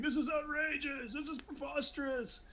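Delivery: very high, drawn-out cries of indignation; hammed-up high-pitched senator outrage; mock outraged protesters. Senators standing up against the accusation, mock indignant high voice.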